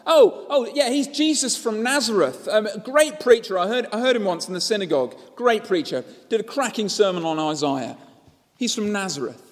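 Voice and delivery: breezy chatter about a famous preacher; casual bystander. Retold or imitated. Preacher voices dismissive crowd member.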